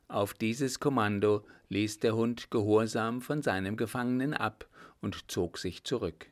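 ordinary delivery, nothing marked

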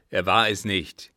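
loud, firm denial; commanding old captain. Acted. Father contradicting his mad son, commanding. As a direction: state it as a forceful order. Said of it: "gebot der Alte".

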